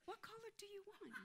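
high, soft, syrupy helpfulness; smiling sweetness. Acted. Suddenly helping the lady she resented, sweetly smiling. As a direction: put on a high, gentle smile in the voice. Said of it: "now I had a smile".

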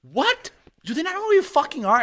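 high-pitched, over-the-top indignant fan voice; outraged fan. Retold or imitated. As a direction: play the fan who can't believe you're stuck outside, pitch way up. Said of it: "One kid will walk up to me and be like".

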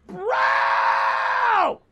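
high, loud cry of despair; anguished shout. Real, not acted. Streamer overwhelmed by orders, high anguished cry. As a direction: shriek it out, at the end of your rope.

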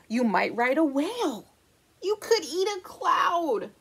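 lively picture-book read-aloud; reading to children. Acted. Librarian reading the book to children, lively storytelling. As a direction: read it to a room of little kids.

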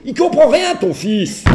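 very high, loud laughing exasperation; father fed up, loud high complaint to mother; exasperated outburst. Retold or imitated. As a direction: blow up to the mother. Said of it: "je me retournais vers sa mère".

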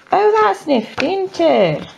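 bright, high and delighted; gushing. Real, not acted. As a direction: gush over the little workbook. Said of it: "Sorry if I sound like I'm gushing".

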